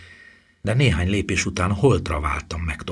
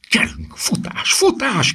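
urgent shout leaping high; frantic command rising to a high cry; 4 dB louder, slower than usual. A narrator's reading. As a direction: yell at someone to run. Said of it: "kiáltott Hermione-re Harry".

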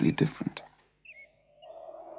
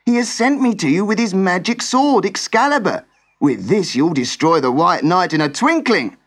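much higher, louder urgent servant; 7 semitones higher, 4 dB louder than usual. Acted. Servant rouses sleeping Sir Accolon to fight, high and urgent. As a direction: shake him awake, hurried and pressing. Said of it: "cried the servant urgently".

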